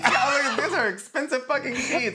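high, loud comic indignation; scandalized squeal; indignant protest. Real, not acted. Indignant about soiled sheets.

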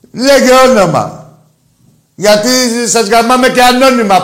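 loud, high, furious rant; angry shouting. Real, not acted. Host shouts at an anonymous caller demanding his name, furious.

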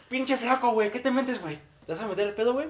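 put-on drunk woman voice. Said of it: "la vieja está bien pedo".

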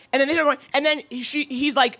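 ordinary talk

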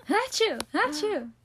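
high, playful fake sneezes; fake sneezing. Shown as a demonstration. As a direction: sneeze on purpose twice. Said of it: "I'll just keep sneezing".

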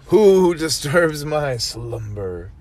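slow, booming awakened statue voice; grinding stone statue. Pretend. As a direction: rumble it out like an ancient stone guardian. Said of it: "Don't do that".

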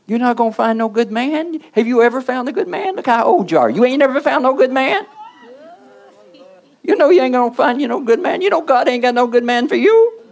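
mock her like a spiteful demon; preacher voicing a lonely woman's devils, high and jeering; taunting devil voice. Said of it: "voice her devils".

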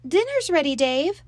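high, loud call after him. Acted. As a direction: call him back across the yard. Mom calling after leaving Dave.

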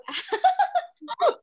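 high burst of real laughter; a big high laugh; loud laugh. Real, not acted. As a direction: crack up loudly.

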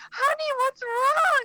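high, slow worried mom voice; mock concerned mother; imitating mom. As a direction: do mom asking sweetly what's wrong. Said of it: "My mom goes".

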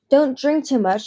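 acerbic. Acted. Sister scolding the fugitive at the pool, acerbic. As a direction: bite the words at her sister. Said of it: "she said acerbically".

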